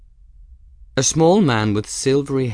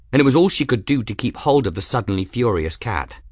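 ordinary delivery

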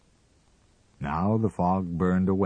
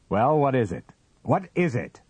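raised, louder impatient demand; shouting; 7 semitones higher, 4 dB louder than usual. A narrator's reading. Husband demands to hear the dream, shouting. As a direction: shout it, pitched high and insistent. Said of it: "he shouted".

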